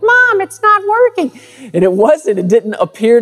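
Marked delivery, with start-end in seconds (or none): 0.0-1.4 put-on very high, squeaky little-boy whine; exaggerated imitation of a frustrated child in falsetto; whiny frustrated kid. Third grader whining to Mom about his project, hammed-up frustration. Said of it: "Maybe more like".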